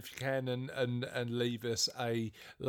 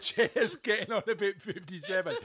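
laughing; 5 semitones higher, 4 dB louder than usual. Real, not acted. Laughing, reading review name.

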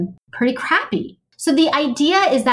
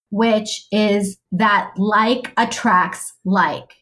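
slow emphasis; much slower than usual. Real, not acted. Defining the law slowly.

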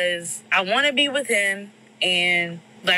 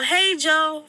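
higher, louder hey, Joe; yelled name; 7 semitones higher, 4 dB louder than usual. Retold or imitated. A voice outside yells for her boyfriend by name. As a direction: call his name out loud again. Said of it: "I hear somebody yell".

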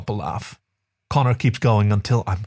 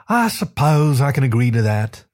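dramatic sigh; 4 semitones higher than usual. A narrator's reading. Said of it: "He sighs dramatically".

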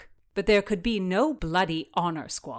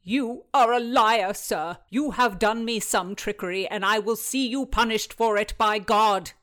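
loud, higher indignant accusation; heated, forceful outburst; exploding in anger; 4 semitones higher, 6 dB louder than usual. A narrator's reading. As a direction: explode at him in outrage. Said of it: "Wyatt exploded".

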